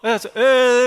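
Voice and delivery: mock child fake-crying; fake whiny crying. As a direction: mimic a fussy kid's put-on cry. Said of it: "애가 이렇게 막".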